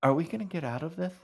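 high, fast, worried blurt; anxious question. Acted. Scared girl in the car.

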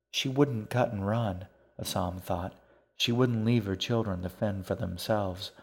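ordinary delivery

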